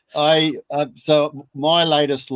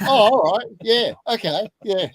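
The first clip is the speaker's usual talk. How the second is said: higher, louder, sudden delighted giving in; giving-in customer; 7 semitones higher, 3 dB louder than usual. Retold or imitated. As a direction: play a customer won over at once. Customer caves at breakfast whiskey.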